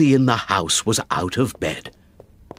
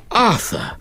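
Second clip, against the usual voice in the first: cried out; 11 semitones higher than usual. Acted. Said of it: "I cried".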